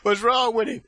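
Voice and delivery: high, loud cry of the aunt; mock alarm. Aunt fearing nephew is possessed, loud mock alarm. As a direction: imitate an auntie calling out in alarm.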